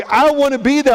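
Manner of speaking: high, insistent plea; impassioned pleading. Acted. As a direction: plead it out with full conviction. Joseph's plea at its height.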